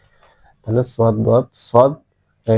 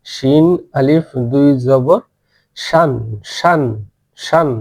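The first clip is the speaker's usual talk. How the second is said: slow, drawn-out spelling chant; teacher's slow drill chant; slow recitation demo; slower than usual. Shown as a demonstration. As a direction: chant each part slowly for students to copy. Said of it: "এভাবে উচ্চারণ করে নেবেন".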